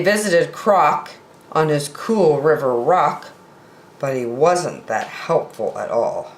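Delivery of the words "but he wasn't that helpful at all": ordinary delivery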